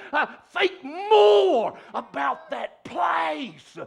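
high, loud, shouted preaching; roaring pulpit climax; fiery preaching. Acted. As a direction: preach it out at full force. Preaching at full force.

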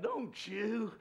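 ordinary talk

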